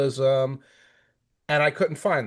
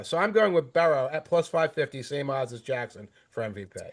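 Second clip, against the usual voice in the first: ordinary delivery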